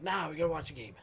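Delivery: loud, eager chant; excited sports fan. Retold or imitated. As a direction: push it like a fired-up fan. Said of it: "those of us sports fans were like".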